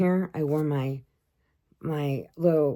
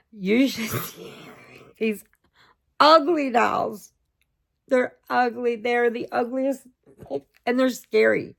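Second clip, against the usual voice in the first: laughing; 6 semitones higher, 3 dB louder than usual. Real, not acted. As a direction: laugh through it, marvelling. Laughing exclamation about dolls.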